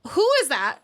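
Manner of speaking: high, loud disbelieving cry; shocked. Real, not acted. Co-host seeing the wrestler's photo, shocked and loud. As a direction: blurt it out in shock.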